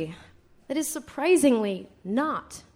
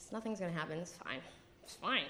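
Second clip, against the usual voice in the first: much softer and lower, reassuring herself; self-soothing mutter; 7 semitones lower, 14 dB quieter, faster than usual. Real, not acted. [name] convincing herself nothing will happen, much softer. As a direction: talk yourself down quietly.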